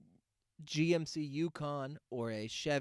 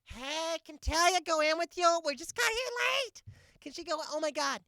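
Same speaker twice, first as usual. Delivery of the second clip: shrill mom yelling out a car window, much higher; imitating a mom yelling from her car window, much higher; 4 dB louder than usual. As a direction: squeal it like an overeager mom calling from her car. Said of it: "mom voice again".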